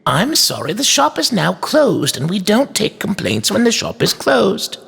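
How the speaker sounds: put-on high, polite but firm old shopkeeper; curt toy maker. A narrator's reading. Toy maker closes shop to inspector, curt. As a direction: send him off in a high-pitched shopkeeper voice.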